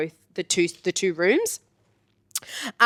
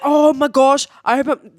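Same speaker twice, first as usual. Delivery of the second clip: hammed-up imitation of a male traveler; 5 semitones higher, 6 dB louder than usual. Rugby guy apologising to the tour leader, imitated male voice. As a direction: put on a guy's voice, raised and loud.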